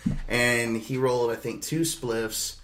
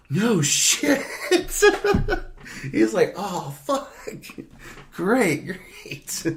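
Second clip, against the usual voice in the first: high squeaky pleased voice with giggle; thrilled buddy reacting; high voiced friend, laughing then breathy; delighted friend; 10 semitones higher, 5 dB louder, much slower than usual.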